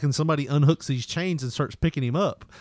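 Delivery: ordinary talk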